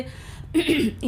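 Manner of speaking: demonstrative throat clear; clearing phlegm from the throat. Shown as a demonstration. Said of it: "এইভাবে গলাটাকে বারবার পরিষ্কার করতে হচ্ছে".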